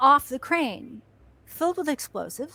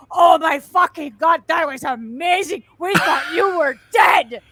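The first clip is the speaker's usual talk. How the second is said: louder, excited rave; amazed exclaim; 3 semitones higher, 7 dB louder than usual. Retold or imitated. Director praising the stuntmen, excited rave. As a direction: gush in relief and awe.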